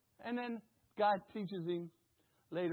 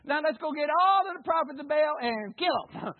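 cocky prophet; 5 semitones higher, 6 dB louder than usual. Retold or imitated. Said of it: "he was like".

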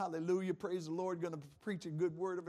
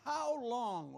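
higher, drawn-out lament; David crying out; 6 semitones higher, 3 dB louder than usual. Acted. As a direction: plead like a psalmist. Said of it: "Can you imagine David saying that".

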